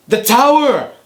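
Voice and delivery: high, loud mock Arnold yell; hammed-up tower cry; imitating Arnold. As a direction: shout it like a hammy action hero. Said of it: "he looks back and he's like".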